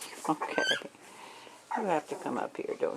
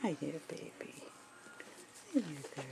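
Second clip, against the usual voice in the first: whispering; 3 semitones lower, 11 dB quieter, faster than usual. Real, not acted. Whispering to the puppy.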